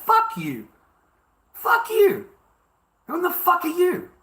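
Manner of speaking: high, furious yelled retort; angry retort. Retold or imitated. Telling off someone who wants control over what he says, furious. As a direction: throw each curse out high and hard, re-enacting the outburst. Retelling his retort to critics.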